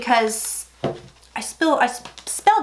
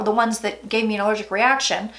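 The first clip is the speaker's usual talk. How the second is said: normal talking voice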